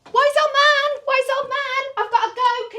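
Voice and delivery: high, loud call; playful call. Acted. Storyteller calling to the wise old man for help.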